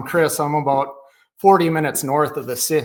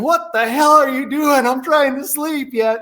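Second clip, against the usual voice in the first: mock yell as the woken dad, higher and louder; retold angry dad; 10 semitones higher, 4 dB louder than usual. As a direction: bark it like a half-asleep father, a bit hammed-up. Dad catches him at dawn.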